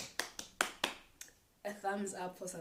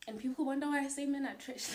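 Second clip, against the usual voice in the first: exasperated; 8 semitones higher, 7 dB louder, faster than usual. Real, not acted. Exasperated punchline.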